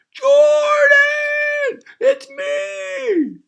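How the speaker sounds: high, loud shout of a name. Acted. Big guy shouts to wake Jordan, very high and loud. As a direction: yell it up high across the trees.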